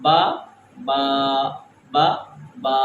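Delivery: stretched vowel demo; stretched baa. Shown as a demonstration. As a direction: hold the a of با for one alif. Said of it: "جیسے میں نے".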